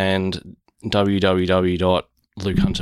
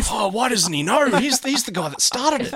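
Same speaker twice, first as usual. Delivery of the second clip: much higher, mock-incredulous listener; indignant listener. Apologising guest mimics listeners catching the mistake, indignant. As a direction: voice an outraged listener in a high pitch. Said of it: "everybody that listened to that and gone".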